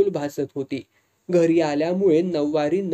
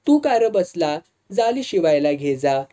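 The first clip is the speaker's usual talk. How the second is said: usual speaking voice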